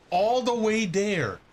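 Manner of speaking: drawn-out exasperated disbelief; incredulous. Real, not acted. Repeats in disbelief.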